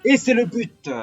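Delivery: high, loud goal call; goal shout. Acted. Commentator calls a goal.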